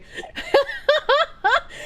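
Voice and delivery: high-pitched cackling burst; high laugh. Real, not acted. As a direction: crack up in a high voice. Bursts out laughing mid-rant.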